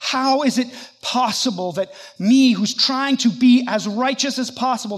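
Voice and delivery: anguished, insistent questioning; wrestling with suffering. Acted. Job questions God in his suffering, anguished and insistent. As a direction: challenge God, pressing each question harder. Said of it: "he wrestles with the idea".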